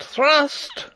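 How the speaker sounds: very high, loud cry ending on a rise; rising cry. Acted. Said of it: "cried Rupert again".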